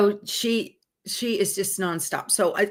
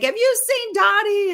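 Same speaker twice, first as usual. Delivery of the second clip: panicked question; 13 semitones higher, 3 dB louder than usual. Retold or imitated. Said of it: "I'm like".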